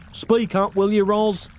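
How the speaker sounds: shouting. Acted. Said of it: "shouted Nick".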